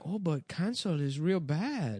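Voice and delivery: a light imitation of a confused mom; parent voice. Parent mishearing cancel culture, lightly imitated.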